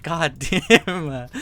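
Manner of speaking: a laughing, exasperated god damn; half-laugh the curse out in frustration; amused frustration, laughing. Retold or imitated.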